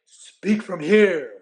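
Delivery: slow, loud coaching from the chest; slow directing coach. Retold or imitated. Referee coaching the announcer during a mic check, slow and loud. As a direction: press the words down like a coach. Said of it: "He says".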